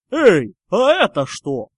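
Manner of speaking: loud, slow, oafish call. Acted. Goyle spotting the ball in the grass, calling out. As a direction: bellow it with drawn-out words.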